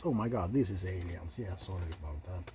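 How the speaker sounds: ordinary talk, nothing marked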